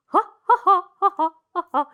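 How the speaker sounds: high, rhythmic ha-has; gasp, then bounce out excited ha-has; happy dance noises. Acted. Puppet dancing about pizza for dinner, excited ha-has. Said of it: "That's a happy, nice dance".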